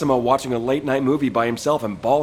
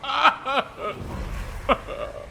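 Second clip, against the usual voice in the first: laugh; 9 semitones higher, 4 dB quieter than usual. Real, not acted. Friend mocks Esimo's grief.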